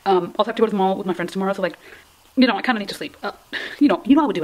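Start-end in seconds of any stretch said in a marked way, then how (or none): none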